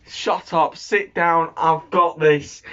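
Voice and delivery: ordinary talk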